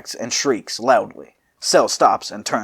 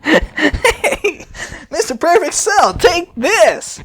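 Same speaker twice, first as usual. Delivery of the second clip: squeaky high musing inventor; high Fu pondering aloud; 4 dB louder, much slower than usual. Acted. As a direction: go way up high and play the giddy scientist. Fu voiced in the story.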